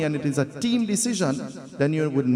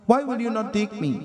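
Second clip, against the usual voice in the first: put-on child's protest; 6 semitones higher than usual. A son pleads with Parvati to take him, in a higher insistent whine. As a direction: pitch jumps higher on an insistent "why". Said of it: "And Lord Ganesh says".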